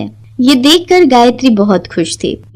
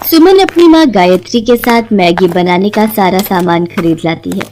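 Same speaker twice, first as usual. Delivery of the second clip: plain reading voice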